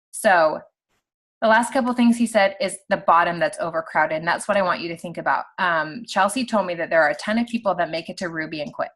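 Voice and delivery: usual speaking voice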